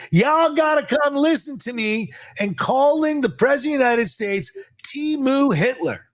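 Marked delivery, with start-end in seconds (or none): 0.1-2.1 pitched up mocking a politician's rallying call; a lightly sneering impression, raised high; mocking quote. Host mocks congresswoman's call to listeners, pitched up mockingly. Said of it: "you got people saying".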